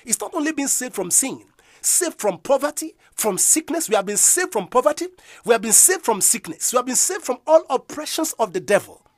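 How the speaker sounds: loud, high declaring preach; preaching at full force. Acted. Preacher proclaiming salvation to the congregation, loud and high. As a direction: drive every line home.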